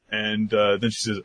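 ordinary talk, nothing marked

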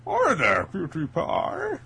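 a hint of Bane, slow and drawn; flirty line in a lightly put-on voice. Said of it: "do your Bane voice".

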